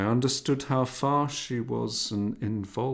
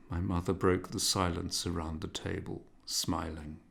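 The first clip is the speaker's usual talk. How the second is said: ordinary delivery, nothing marked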